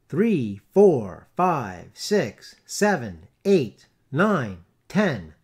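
loud, tolling count, each number struck; the bell counting. A narrator's reading. Big bell counting the hours, loud. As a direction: strike every number like a bell. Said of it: "said the bell".

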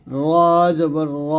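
র rounded to a full "ro"; thick, heavy ra. Shown as a demonstration. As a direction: hold a heavy, rolled র. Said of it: "জবর এবং পেশ দিয়ে উচ্চারণ করলে মোটা হবে".

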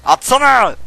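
loud, high demand; frustrated yell at the game; angry shout. Real, not acted. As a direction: bark it at the screen. Yelling at a game character.

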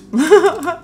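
high burst of giggles; amused laugh. Real, not acted. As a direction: laugh at your own silly remark. Amused at her own post remark.

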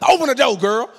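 high, loud, pressing order; urgent demand. Retold or imitated. Husband locked out by wife, urgent loud demand. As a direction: shout it through the door.

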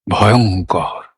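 low, drawn-out word full of feeling; expressive dread. Shown as a demonstration. Teacher shows how the word carries its feeling, expressive dread. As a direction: let ভয়ঙ্কর carry the dread, low. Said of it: "ঠিক যেভাবে অনুভবটা প্রকাশ করেছিলাম".

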